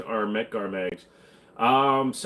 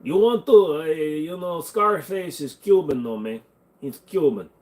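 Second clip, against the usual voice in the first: mock Cuban accent; Scarface; 3 semitones higher, 3 dB louder than usual. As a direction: play it as a hammed-up Cuban movie gangster. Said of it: "You Scarface is a reference".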